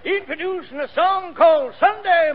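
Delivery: slow, measured song introduction; formal, drawn-out master of ceremonies delivery; stage announcer. Acted. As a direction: present the next act to the whole theater.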